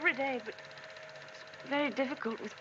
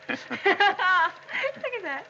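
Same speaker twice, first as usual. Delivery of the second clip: laughing through the words, slow and louder; 8 semitones higher, 7 dB louder, much slower than usual. Acted. Wife watching honeymoon films with husband, laughing. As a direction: giggle as you say it.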